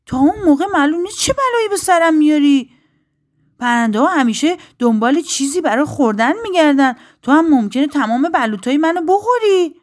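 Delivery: high, loud shouts of an outraged oak; indignant shouting. A narrator's reading. Oak turns away the little bird, shouting indignantly. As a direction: shout it in high-pitched disbelief. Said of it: "درخت بلوط فریاد زد".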